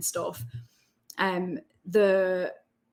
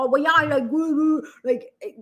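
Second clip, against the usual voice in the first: mock Geordie, higher and louder; thick mock Geordie; 7 semitones higher, 6 dB louder than usual. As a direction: ham up a thickened Geordie accent. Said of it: "starts thickening up their accent".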